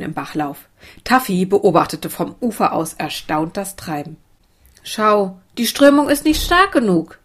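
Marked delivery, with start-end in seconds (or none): 4.8-7.2 higher voice with a touch of disappointment; mild sadness. A narrator's reading. Isa to Sophia about the weak current, mildly sad. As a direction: complain a little sadly, pitch lifted. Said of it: "sagte Isa traurig".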